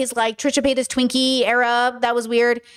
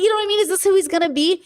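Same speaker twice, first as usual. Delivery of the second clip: ordinary delivery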